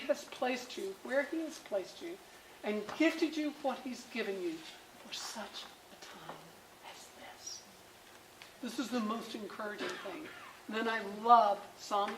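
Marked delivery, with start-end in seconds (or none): 5.0-7.6 hushed, fading to a whisper. Shown as a demonstration. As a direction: drop much softer, last words breathed. Same verse quoted twice.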